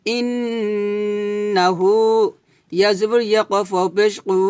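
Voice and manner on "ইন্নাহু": ইন্নাহু with a long humming nasal on ইন্ন and হু held one beat; nasal ghunna. Shown as a demonstration. Teacher showing obligatory ghunna in ইন্নাহু. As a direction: hum through the double n, very slowly. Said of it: "হু এক লিপ টান হবে".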